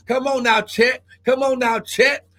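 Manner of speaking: ordinary talk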